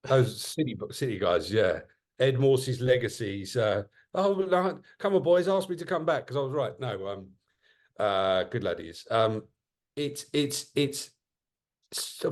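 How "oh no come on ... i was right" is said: higher pleading voice, playful; teasing impression. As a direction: tease him as a gloating old hand.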